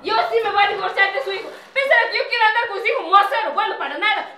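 fast, high, loud rant; angry rant. Acted. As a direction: rattle it off in fury. Nuera se marcha furiosa. (diatriba rápida y furiosa)

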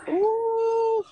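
a high squealing ooh; squealed ooh. Real, not acted. Told she missed dinner, a high squealing ooh.

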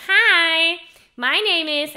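loud, high, sharp twangy voice; heavy twang. Shown as a demonstration. Teacher gives students a line to try, heavy twang. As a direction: make it bright and ugly with twang. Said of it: "with a lot of twang".

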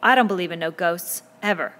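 loud, indignant denial; affronted and forceful. A narrator's reading. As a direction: refuse it emphatically. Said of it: "His voice was indignant".

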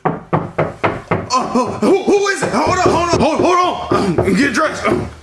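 high hurried call to the door; flustered panic. Acted. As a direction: stall the visitor nervously. Caught by knock, flustered.

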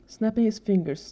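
usual speaking voice

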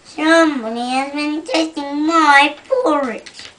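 loud booming bear, lightly voiced; great big bear voice. Reading to a child, the great big bear complains loudly. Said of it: "said the great big bear in his great big voice".

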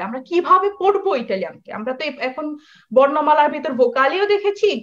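normal talking voice

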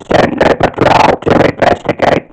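loud, barked mechanical alert; robot alarm voice. Shown as a demonstration. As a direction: announce the invasion like a ring-modulated alien machine. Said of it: "use vocal technique".

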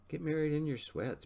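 narrated yell, pitch jumping up. A narrator's reading. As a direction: call it out high, as if through a door. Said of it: "Brandon yelled".

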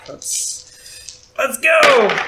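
excited shout. Acted. Charging into action.

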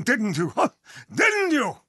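ordinary talk

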